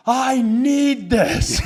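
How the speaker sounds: slow, drawn-out high scream; mock scream. Mom screaming while taking out the trash, drawn-out scream. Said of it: "And she just screams".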